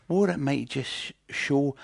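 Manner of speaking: ordinary delivery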